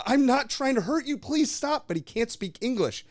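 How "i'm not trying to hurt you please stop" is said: much higher, pleading cry; pleading troll. Acted. Troll begging the Fellowship not to shoot, high and frightened. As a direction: beg them to stop in a frightened high voice. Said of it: "And Candish is just like".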